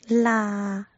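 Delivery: 啦 with the air left open, no stop; wrong open ending. Shown as a demonstration. Teacher demonstrating the mistake, 啦 left open. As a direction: let the air run out on 啦. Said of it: "没有把气流截住的话".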